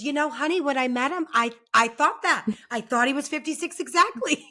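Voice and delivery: imitating Kathy. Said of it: "And she goes".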